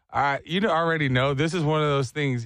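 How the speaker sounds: normal talking voice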